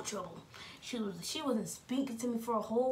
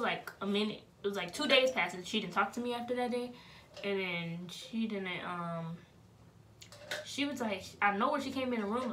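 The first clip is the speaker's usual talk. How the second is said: normal talking voice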